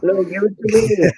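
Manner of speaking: put-on high whiny plea like a kid; mock childish whine; whiny begging. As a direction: beg for it like a spoiled child.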